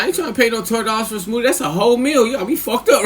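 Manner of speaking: high, fast put-on voice refusing to pay; indignant inner voice. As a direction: rattle off the outrage fast and high. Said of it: "I'm like".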